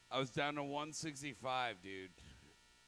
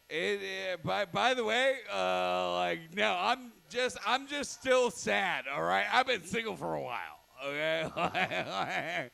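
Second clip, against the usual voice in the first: defensive loud; 3 semitones higher, 7 dB louder than usual. Real, not acted. Defensive insistence about being single.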